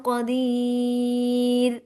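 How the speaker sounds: slow melodic tajweed recitation; Quran recitation. Shown as a demonstration. Teacher reciting verses to her students, slow melodic tajweed. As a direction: chant each verse slowly with tajweed.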